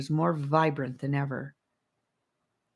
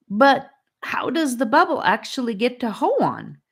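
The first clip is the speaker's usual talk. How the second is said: stammered; 8 semitones higher, 5 dB louder than usual. A narrator's reading. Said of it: "Charlie stammered".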